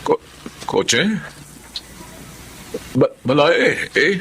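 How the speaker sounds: high, halting confusion; lost, groping for words; forgetful father stammering to his in-law; confused stammer. Acted.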